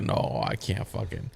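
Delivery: low, slow retold refusal; mock worn-out mother. Mother after Teddy's birth, mock-exhausted refusal. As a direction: drop it low and soft, drained.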